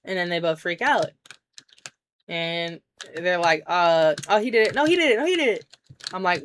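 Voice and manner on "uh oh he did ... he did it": frantic, higher voice pointing fingers; hurried, scared it-was-him; panicked blaming. Retold or imitated. Boys caught losing the hamster, panicked blaming.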